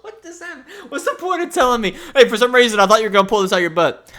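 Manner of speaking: high, helpless laughing complaint; laughing protest. Real, not acted. Guesser questioning the host's point, laughing protest.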